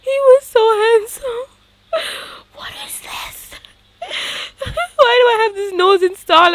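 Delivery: high, loud, slowed mock wail; hammed-up sob over a ruined face; mock sobbing. Hammed-up crying over the randomized Sims nose. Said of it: "I'm so hurt".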